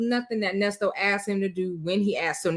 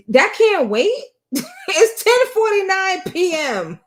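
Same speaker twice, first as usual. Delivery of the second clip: high, loud, laughing disbelief; incredulous laughing; 10 semitones higher, 5 dB louder than usual. Real, not acted. Mocking the caller's late-night request.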